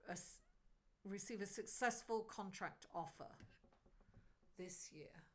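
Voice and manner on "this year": soft whisper to herself. Real, not acted. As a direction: breathe the words, barely voiced. Whispered question over cards.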